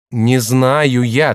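slow, emphatic refusal; stubborn insisting. A narrator's reading. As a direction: dig in and stretch each word. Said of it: "твердил Коске".

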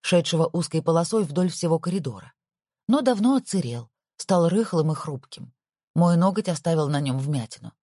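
usual speaking voice

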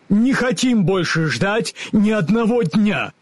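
high, vigorous backing; energetic. A narrator's reading. The Woodman energetically backs the Scarecrow. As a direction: back up your friend forcefully. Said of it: "энергично поддержал товарища".

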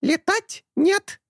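high slow stubborn squawk; stubborn bird. A narrator's reading. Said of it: "упрямо повторил Орев". (упрямый птичий крик)

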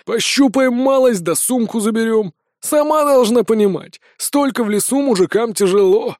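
mock-reasonable robber voice; leering bandit chief. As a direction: cajole her with menace.

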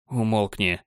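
отчаянное сдавленное шипение; прошипи сквозь зубы, в отчаянии. Acted. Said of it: "в отчаянии прошипел Марк". (прошипел в отчаянии)

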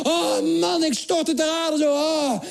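high-pitched melodramatic collapse; hammed-up agony, drawn-out groans; super dramatic. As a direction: play the fall like a war-movie death. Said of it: "super dramatisch, net als in die film".

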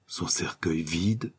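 slow, soft, questioning disbelief; stunned echo. A narrator's reading. Detective hears that the coffin is empty, stunned echo. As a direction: repeat it slowly, dumbfounded. Detective echoes shocking news.

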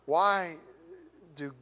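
normal talking voice

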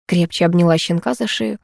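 plain reading voice

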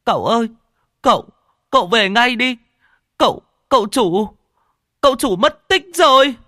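high, loud sobbing plea; bursting into sobs. A narrator's reading. Coachman boy sobs to Toàn that his son is missing, high wailing. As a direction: wail it out like a panicked boy in tears. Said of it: "nó òa lên nức nở".

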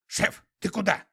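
high creature voice; startled animal sidekick; skunk voice. A narrator's reading.

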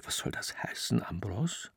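hissed, soft and breathless. Acted. Juliana in the salon hisses at Ambros, angry whisper. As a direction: hiss it low so no one hears. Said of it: "Juliana zischte".

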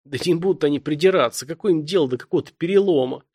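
plain reading voice

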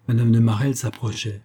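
ordinary delivery, nothing marked

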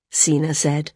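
ordinary delivery, nothing marked

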